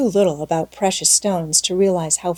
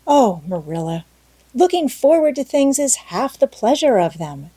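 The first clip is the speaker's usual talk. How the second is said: lightly raised, eager exclamation; exclaiming; 6 semitones higher, 3 dB louder than usual. Acted. Anne answering Marilla's warning, eagerly exclaiming. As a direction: burst out in happy protest. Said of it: "exclaimed Anne".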